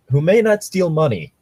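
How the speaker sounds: ordinary talk, nothing marked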